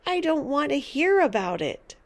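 understated cry of disgust; cried out. A narrator's reading. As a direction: protest in mild horror. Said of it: "cried George".

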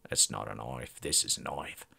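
an Aussie bushman sizing up a knife, low and deadpan; mock Australian bushman. Gamer quoting the knife scene to his squad in a low Australian voice.

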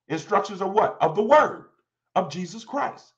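ordinary delivery

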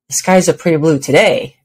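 "today" emphasized with a rising pitch; stress on today. Shown as a demonstration. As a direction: lift the end of "today". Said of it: "with emphasis on a different word each time".

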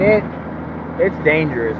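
ordinary talk, nothing marked

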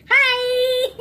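high, loud, drawn-out hello; big delighted greeting; excited greeting. Real, not acted. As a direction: squeal hello to a dog.

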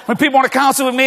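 ordinary delivery

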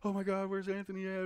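high-pitched mock panic; high, frantic retelling; retold freak-out. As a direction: act out a worried, panicked search. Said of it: "we're freaking the fuck out".

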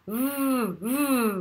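long revving R; motorbike starting. Shown as a demonstration. Teacher giving a Texas trick for R, motorbike revving noise. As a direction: rev up like a motorbike on an R. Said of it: "c'est une moto qui démarre".

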